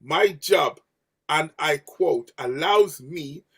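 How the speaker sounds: slow, spelled-out emphasis; slow and deliberate. Shown as a demonstration. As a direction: read it out slowly so they listen. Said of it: "listen to me very carefully".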